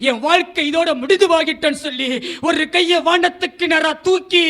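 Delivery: ordinary delivery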